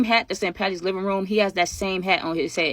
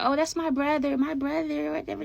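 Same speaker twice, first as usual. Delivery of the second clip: high, breathy mock of a girl gushing; mocking girly voice; 8 semitones higher, 6 dB quieter than usual. Teller mocking girls who call any guy brother, in a high, soft girly voice. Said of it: "'Cause you know how y'all get".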